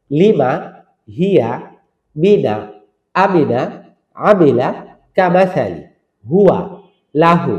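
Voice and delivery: each word read crisply with short, quick vowels and a pause between words; quick Quranic recitation. Shown as a demonstration. Teacher reciting the lesson's word list for students to copy. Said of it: "আপনাকে উচ্চারণ করতে হবে কিভাবে?".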